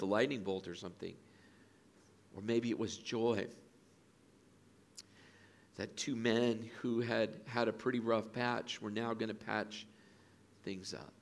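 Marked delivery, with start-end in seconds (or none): none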